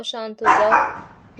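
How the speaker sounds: drawn-out शांत हो जाओ, said slowly and calmly; calm the puppy down, very slowly; slow soothing. Acted. Soothing a barking dog. (धीमी, शांत आवाज़ में)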